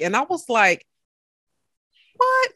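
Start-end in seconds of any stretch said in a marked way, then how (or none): 2.2-2.5 sharp, much higher disbelieving "What?"; shocked what. Retold or imitated. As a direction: blurt it out in total disbelief. Retold shock at listener emails.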